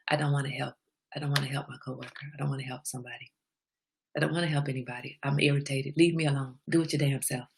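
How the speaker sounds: low, quiet retold grumble; understated irritation. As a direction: grumble it softly, half to yourself. Retells own irritated complaint to friend.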